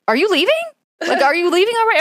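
high, puzzled question on the phone; puzzled boyfriend. Retold or imitated. Boyfriend on the phone asking if she's leaving, high and puzzled. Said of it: "He's like".

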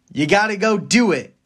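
ordinary delivery

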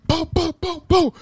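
high-pitched mimicked "boom" knocks; imitated door banging. Half-asleep son hearing knocks, high imitated booming.

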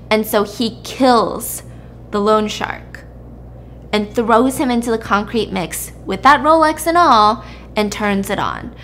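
ordinary delivery

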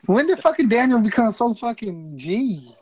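loud, high disbelieving question; incredulous. Real, not acted. Shocked teasing question.

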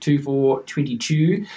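put-on slow commentator accent, "two" drawn out as "chew"; imitated commentator accent, "twenty-two" as "twenny-chew"; Richie Benaud. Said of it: "very famous pronunciation of the number two".

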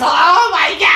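high, loud mock shriek; hammed-up shocked outburst; Fran Drescher. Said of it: "do her voice as well".

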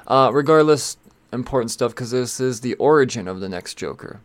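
ordinary talk

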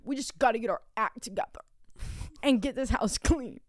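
whispery, strained put-on voice; mocking husband imitation. Husband urging wife to tidy, whispered mock imitation.

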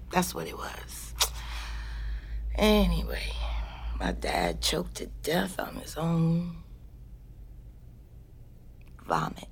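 low, hushed telling of a grim fact; quiet confession. Acted. Telling a friend how her dad died, hushed. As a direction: drop to a near whisper for the bad news. Dark confession of dad's death.

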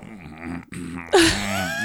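high, squeezed stifled laughter; wordless suppressed giggle, imitated. Shown as a demonstration. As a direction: demonstrate trying to hold a laugh in. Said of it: "you hear me go".

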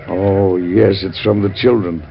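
ordinary talk, nothing marked

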